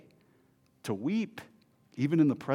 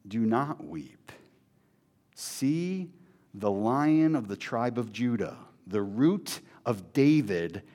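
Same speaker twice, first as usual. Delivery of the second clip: gentle comfort; 5 semitones lower, 4 dB quieter, slower than usual. Acted. Voicing the elder's comfort.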